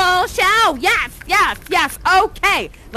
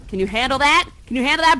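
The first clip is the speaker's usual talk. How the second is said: ordinary talk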